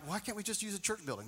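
soft, fast grumble; kid's complaint. Retold or imitated. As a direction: gripe like a bored thirteen-year-old. Kid complaining about brush arbor.

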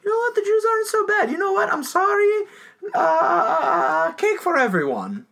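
mock Hitler voice. Joking to the co-host as a remorseful Hitler, squeaky high voice. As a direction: play a silly, suddenly nice dictator.

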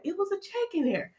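high, soft, amazed reveal; hushed amazed reveal. Retold or imitated. Vlogger revealing what was in the mailbox, hushed amazement. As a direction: reveal the surprise softly, pitched high. Suspenseful reveal of a surprise check.

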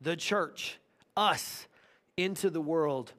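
soft, slow, pleading prayer voice; Jesus asking the Father, voiced quietly and tenderly; gentle prayer. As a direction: pray it softly, almost under the breath. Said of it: "And he says".

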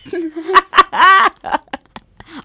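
loud, high cackling laugh; long, high laughing fit; big burst of laughter. Real, not acted. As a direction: crack up helplessly. Cracking up at the heel claim.

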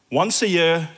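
ordinary delivery

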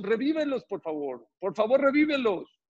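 high-pitched imploring, dipping midway; acted pleading. The Torah begs God for the people, acted high plea. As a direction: beg with a soaring voice.

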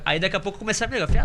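usual speaking voice

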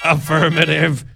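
monotone robot voice, pitch held level; robot impersonation. Pretend. Podcaster showing off a robot impersonation for listeners, monotone robot voice. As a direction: intone it evenly like a machine. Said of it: "That's my robot impersonation".

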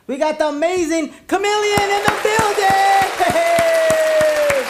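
high, loud guest announcement shout; hype shout. Acted. Host announces guest loudly.

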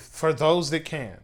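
normal talking voice

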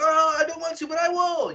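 very high, hammed-up reluctance; mock protest that gives in; Joker. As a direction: whine it like a reluctant subordinate. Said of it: "And then he's like".

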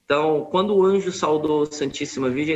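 ordinary talk, nothing marked

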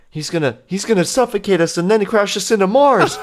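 high, frantic panic; panicked. Acted. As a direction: shriek the threat in terror. Crew panics.

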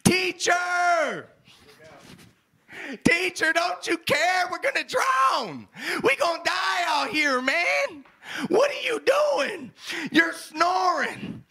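high-pitched frantic yelling, acted out; panicked shouting. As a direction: shout like a disciple about to drown. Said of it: "the disciples woke him up shouting".

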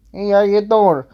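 high, loud hammed-up pickup line; Joey's pickup line. Fourth date greeting the listener, a high loud hammed-up pickup line. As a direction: belt it like a cheesy flirt. Joey catchphrase loud take.

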